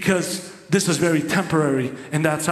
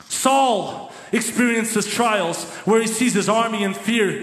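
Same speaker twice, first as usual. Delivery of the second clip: normal talking voice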